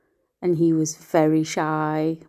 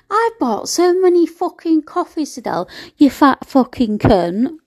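higher, louder mock rant; imitating ex yelling; 8 semitones higher, 5 dB louder than usual. Quoting her ex's abuse to the viewers, loud and mocking. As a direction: spit out the insults as he did.

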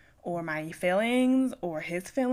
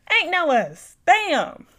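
much louder, higher flat-out dismissal; 10 semitones higher, 13 dB louder, much slower than usual. Retold or imitated. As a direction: slam the door on it. Loud retort repeated.

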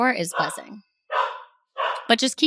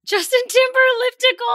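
squealed delight; 3 dB louder than usual. Real, not acted. Mock-repeating the class name.

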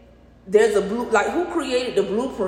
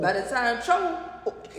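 staccato; 3 semitones higher, 4 dB quieter, much slower than usual. Shown as a demonstration. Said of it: "See how she breaking them words down?".

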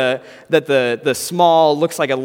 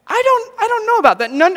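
very high squeaky voice, unsure; squeaky doubt; 6 dB louder than usual. Acted. Doubtful reply to the athlete.

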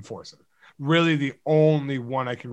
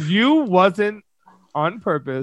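mocking quote; 5 semitones higher, slower than usual. Mockingly quoting the other's grammar.

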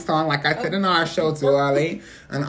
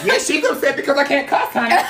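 loud laughing cry; laughing shout; 10 semitones higher, 6 dB louder than usual. Real, not acted. Laughing yell at the dog.

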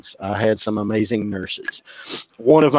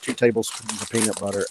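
ordinary talk

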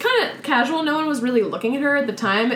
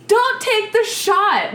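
slow urgent warning; 11 semitones higher, much slower than usual. Retold or imitated. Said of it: "turned to Courtney and go".

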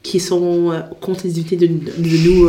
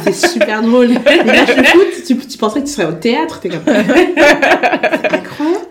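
parler en riant, voix qui monte; 6 semitones higher, 4 dB louder than usual. Real, not acted. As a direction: amusement réel, rire qui déborde dans les mots. Amusée par les matchs de foot. (en riant franchement)

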